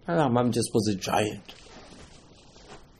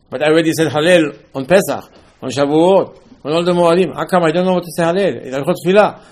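higher, louder, insistent objection; mock indignant protest; 4 semitones higher, 6 dB louder than usual. Student protesting he already said Hallel on Pesach, mock indignant. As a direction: press the complaint as a baffled learner.

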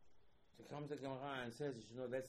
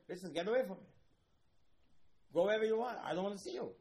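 higher, louder shout pushing someone away; angry rejection; 9 semitones higher, 10 dB louder, faster than usual. Acted. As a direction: bark it like driving him off. God voiced rejecting the sinner.

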